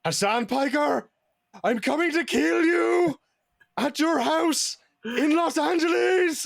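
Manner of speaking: high, slow Irish threat; Irish Sam Hyde impression. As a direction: menace him in a cocky Irish voice. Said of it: "your Irish Sam Hyde impression is very good".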